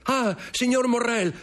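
very high, urgent calling; acted high-pitched shouts; excited lookout's cry. A narrator's reading. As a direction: announce the arrival to everyone below. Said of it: "gridò uno dei convitati".

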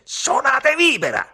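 slow, very high, loud yell; shouted order. A narrator's reading. Ugo orders the band to play his song, shouted order. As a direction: bellow a command at full height. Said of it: "ordinò".

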